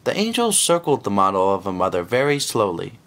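ordinary delivery

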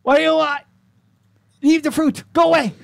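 high, loud little-creature shout; angry little creature. Acted. Pebble creature shouting at the druid to go away, high and loud. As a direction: shriek it like a tiny angry rock.

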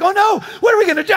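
high, fast, imitated panic; hammed-up frantic wail; freaking out. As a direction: shriek it like a panicking wife. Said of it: "she starts freaking out".

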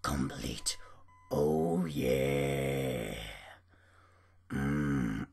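very low, drawn-out creaky growl; silly deep voice. Pretend. Player celebrating a finished night to himself, silly deep voice. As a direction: drop right down and draw it out. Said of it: "I don't know what just happened there".

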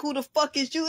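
high, scornful challenge; attitude. Retold or imitated. Mama confronting the man, high-pitched and scornful. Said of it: "And the attitude, like".

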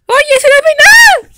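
high, loud, indignant little dog; a toy dog yelping a complaint; squeaky dog voice. Acted. Toy dog protests its new name, high and loud.

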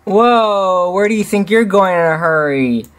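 cheerful. A narrator's reading. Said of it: "Simba asked his daughter cheerfully".

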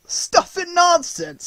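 put-on very high, very slow drawn-out old man's exclamation; crying out. A narrator's reading. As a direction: scoff at the whole affair, hammed up. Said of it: "he cried out".

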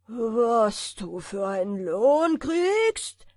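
loud, slow giant voice; booming giant. A narrator's reading. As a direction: rumble it like a giant. Giant repeats the question. (dröhnender Riese)

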